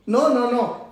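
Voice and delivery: ordinary talk, nothing marked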